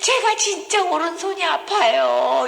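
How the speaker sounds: slow, high imitated sobbing complaint; tearful, childlike wail. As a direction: wail it like a crying child, hammed-up. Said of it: "이렇게 우는 거예요, 애기처럼".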